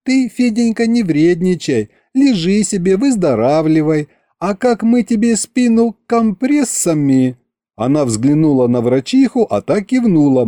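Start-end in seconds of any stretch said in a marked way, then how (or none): 0.0-7.4 tender coaxing. A narrator's reading. Wife coaxes her sick husband, high and tender. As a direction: coax him gently like a child. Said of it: "сменила тон на ласковый".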